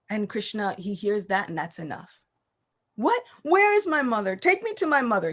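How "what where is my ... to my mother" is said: put-on alarmed child. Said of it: "He begins crying".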